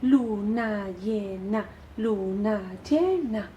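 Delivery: slow chanted syllable-by-syllable count; recitado en marcha. Shown as a demonstration. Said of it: "siempre como si estuvierais haciendo marcha". (recitando sílaba a sílaba, como una marcha lenta)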